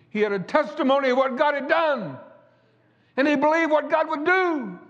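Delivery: high, ringing pulpit cadence; fiery preacher rolling through the testimony; preaching at full force. Acted. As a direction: preach it out to the congregation, building. Preaching again.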